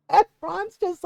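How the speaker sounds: usual speaking voice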